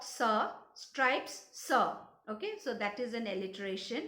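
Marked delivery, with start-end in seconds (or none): 0.0-0.5 short "sir" as the sound of letter S. Shown as a demonstration. Teacher showing students how stalk begins, S sound. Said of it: "we pronounce it as". 1.5-2.0 "sir" as s with a short uh; S sound. Shown as a demonstration. Teacher showing students how stripes begins, S sound. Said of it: "we pronounce it as".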